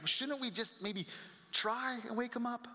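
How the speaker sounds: high-pitched, halting acted question; tentative suggestion. Lone disciple proposes waking Jesus, tentative. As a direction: float the idea, lifting the pitch.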